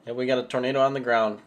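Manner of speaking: loud, urgent announcement; excited. Real, not acted. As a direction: call it out loud, thrilled. Tornado spotted on live stream.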